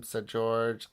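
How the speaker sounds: ordinary delivery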